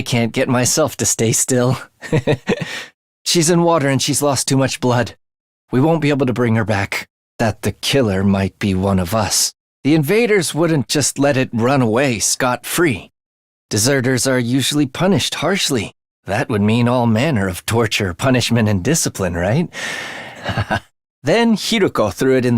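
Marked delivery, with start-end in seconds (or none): 1.8-2.8 breathy giggle. Acted. Night excites the speaker. 19.7-20.8 soft breathy laugh. Acted. Relishing talk of torture.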